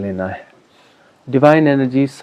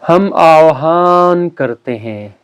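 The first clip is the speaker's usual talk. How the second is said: slow, drawn-out ceremonial call; solemn invocation; 4 semitones higher, much slower than usual. Real, not acted. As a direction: stretch each key word for a group to repeat. Leads a group invocation.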